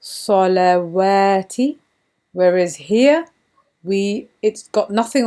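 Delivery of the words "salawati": salawati slow, each syllable clear with the waw sounded: sa-la-wa-ti; careful salawati. Shown as a demonstration. Teacher modelling salawati slowly for students. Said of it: "So we would say".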